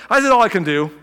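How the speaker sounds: loud, breezy self-excuse; hammed-up easy excuse; mock cop-out. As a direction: toss it off like you're off the hook. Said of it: "but I said that".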